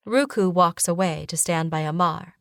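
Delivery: plain reading voice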